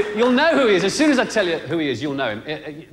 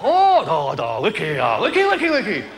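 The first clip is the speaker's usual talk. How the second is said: mock Chinese babble; 3 semitones higher than usual. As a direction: rattle off the one word over and over. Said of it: "He just went".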